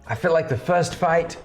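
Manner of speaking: put-on English accent. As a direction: play the gloating bookie in a mild English accent. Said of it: "I was doing the English accent".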